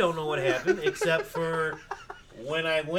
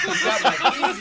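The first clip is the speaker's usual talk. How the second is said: laughing disbelief; 8 semitones higher, 9 dB louder than usual. Retold or imitated. Retelling his disbelief at the neighbor.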